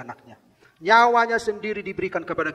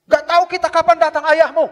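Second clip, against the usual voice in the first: scornful shout; 13 semitones higher, 13 dB louder than usual. Acted. Doubting companions voiced in story.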